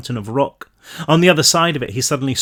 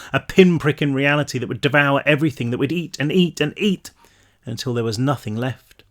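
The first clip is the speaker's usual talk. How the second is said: usual speaking voice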